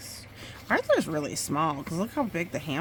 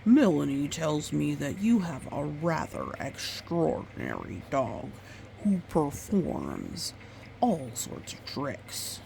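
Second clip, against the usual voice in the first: lightly voiced grandfather. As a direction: speak like a courteous grandpa, lightly. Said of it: "he said".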